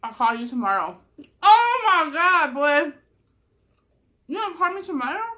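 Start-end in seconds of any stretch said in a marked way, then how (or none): 1.4-2.9 exasperated exclamation. Real, not acted. Reacting to him hanging up. 4.3-5.2 mocking disbelief. Mocking his words in disbelief.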